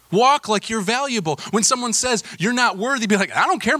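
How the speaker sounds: high, loud, rousing preaching; fired-up, defiant declaration; emphatic exhortation. Acted. Preacher urging defiance of critics.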